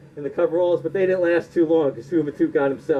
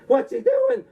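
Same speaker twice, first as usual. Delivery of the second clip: What's he doing? in a much higher squeak; panicked official. Retold or imitated. NWA official panicking as the belt is thrown down, high squeak. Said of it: "The guy in the N W A is like".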